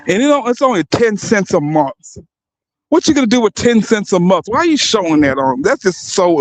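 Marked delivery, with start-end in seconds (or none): none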